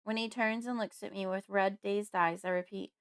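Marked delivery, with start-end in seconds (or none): none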